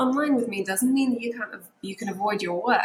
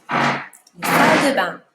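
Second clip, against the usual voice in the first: salle de bain said louder and punched; emphatic repeat; 4 semitones lower, 7 dB louder than usual. Shown as a demonstration. Teacher punches salle de bain for emphasis. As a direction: belt out salle de bain for the class.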